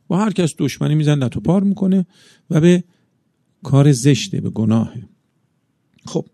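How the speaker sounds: ordinary talk, nothing marked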